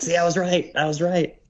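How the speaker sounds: high-pitched retold protest; mock vindication. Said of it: "he's like".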